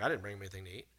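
low, soft mumbled denial; John mutters low and soft; sheepish denial. Retold or imitated. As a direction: mumble it like a disciple with nothing to offer. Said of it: "John's like".

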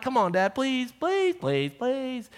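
high whiny please, please, please; kids begging. Retold or imitated. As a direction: beg like little kids, drawn out. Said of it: "And they're like".

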